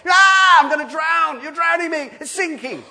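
put-on high, loud scream of a child afraid of drowning; mock panicked screaming. Child screaming at a sibling splashing on the lake, high panicked shrieks. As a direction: shriek it like a panicking kid in the water. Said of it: "starts screaming their head off".

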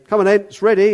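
loud high summons; calling out. Retold or imitated. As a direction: call them over, full voice. Servant calling at each house.

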